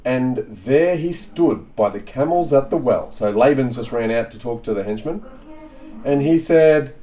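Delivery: ordinary delivery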